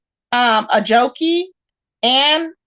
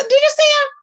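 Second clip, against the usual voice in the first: quoting him. Retold or imitated. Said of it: "He said".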